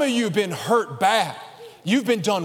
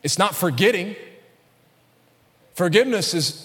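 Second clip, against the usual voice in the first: ordinary talk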